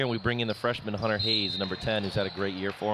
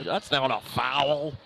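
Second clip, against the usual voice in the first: put-on slow, higher gruff old coach; John Dudley impression; 4 semitones higher, much slower than usual. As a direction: mimic the old coach's complaint. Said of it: "a little John Dudley there".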